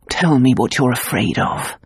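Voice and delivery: a hint of a low coaxing man. A narrator's reading. Luke presses her.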